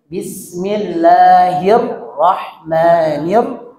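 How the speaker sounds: slow, drawn-out "Bismillahirrahmanirrahim" with doubled consonants held; slow Quran recitation. Shown as a demonstration. Teacher modelling correct recitation for the class. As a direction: recite it slowly, holding the shadda. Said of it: "ditasdid ya".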